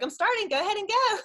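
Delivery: high, loud hurry-up; urgent send-off. Retold or imitated. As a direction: imitate an eager leader launching the start. Acted leader urging her to start.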